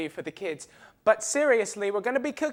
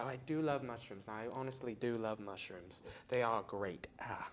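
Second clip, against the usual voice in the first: quiet dazed; 10 semitones lower, 11 dB quieter than usual. Acted. Tastes weird mushroom, fading.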